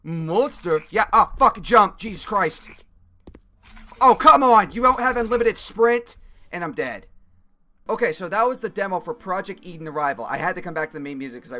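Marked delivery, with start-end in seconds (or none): 0.0-6.2 loud frantic yelling; panicking gamer. Real, not acted. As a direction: shout in a panicked chase. Fleeing monster, then dies.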